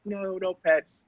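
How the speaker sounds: ordinary talk, nothing marked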